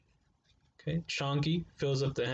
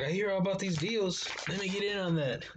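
lightly voiced mom, a bit higher and keen; mom's eager voice; 5 semitones higher than usual. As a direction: imitate her asking to join the deal. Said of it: "She was like".

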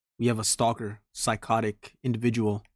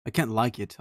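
usual speaking voice

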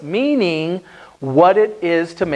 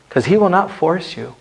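ordinary talk, nothing marked

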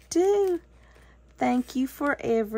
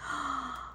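sharp gasp; 13 dB quieter than usual. Real, not acted. Opening a surprise gift.

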